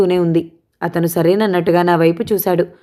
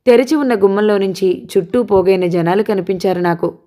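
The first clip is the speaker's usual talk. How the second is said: ordinary delivery, nothing marked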